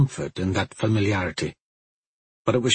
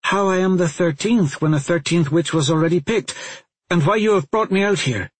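higher, eager reasoning; reasoning fast; 7 semitones higher than usual. A narrator's reading. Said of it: "Alan reasoned fast".

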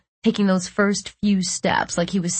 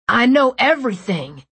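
raised, accusing voice; angry; 5 semitones higher, 5 dB louder than usual. A narrator's reading. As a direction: throw it at him, hurt.

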